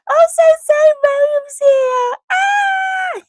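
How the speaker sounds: high, loud joyful shrieking; thrilled high squeals; excited squeal. Real, not acted. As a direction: greet a dear friend with delight. Friend arrives in chat.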